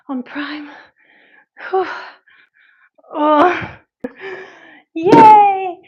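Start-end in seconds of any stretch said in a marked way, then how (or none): 3.1-3.8 loud, high groan of exertion; strained post-set "oh"; effort groan. Real, not acted. Finishing the last set. 4.9-5.8 loud, high "yay"; big drawn-out whoop of triumph; celebratory cheer. Real, not acted. Celebrates finishing the set.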